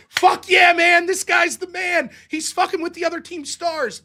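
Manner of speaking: loud, high, drawn-out hype; fan cheering. Acted. Hockey fan hailing a former enemy, loud cheering. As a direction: whoop it up like a won-over fan.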